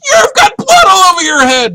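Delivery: high, loud, near-crying yell; wife crying out about the blood; frantic shout. Retold or imitated. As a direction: shriek the news in horror. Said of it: "And she said".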